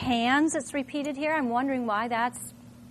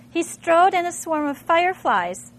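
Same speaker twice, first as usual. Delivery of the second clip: dramatic reading; 6 semitones higher, 8 dB louder than usual. Acted. Teacher reads Fahrenheit passage to class, dramatic read. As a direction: read it like the flames are roaring. Reads novel line aloud.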